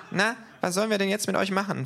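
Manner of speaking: high, cocky kangaroo voice; cheeky kangaroo. A narrator's reading. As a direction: toy with the thugs as the kangaroo. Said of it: "fragt das Känguru". (freches Känguru, hohe Stimme)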